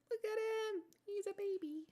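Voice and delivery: high, soft squeal of adoration; cooing over a pet. Real, not acted. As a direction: coo softly at a kitten, way up high. Cooing over her cat.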